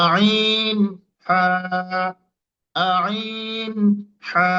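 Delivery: আইন squeezed from the middle of the throat, হা breathy; mid-throat letters demo. Shown as a demonstration. Teacher drills আইন and হা for students, squeezed. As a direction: press আইন from mid-throat, then a clean হা. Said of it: "আমার উচ্চারণ ঠিক হচ্ছে কিনা".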